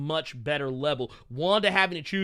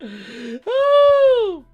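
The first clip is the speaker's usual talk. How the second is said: wheezing laugh; 10 dB louder than usual. Real, not acted. Cracking up.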